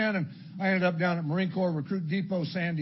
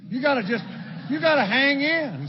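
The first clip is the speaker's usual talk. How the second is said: straining to hold on; 5 semitones higher, 5 dB louder than usual. Acted. Acts straining to keep drink down.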